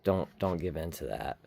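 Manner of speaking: ordinary delivery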